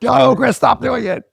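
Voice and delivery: very high, loud plea; mock pleading shout. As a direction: squeal to make him stop.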